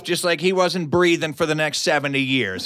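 ordinary delivery

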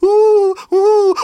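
loud, high wailing coo; upset dove cooing. Acted. Storyteller voicing upset morning doves, loud cooing. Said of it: "everyone was so upset".

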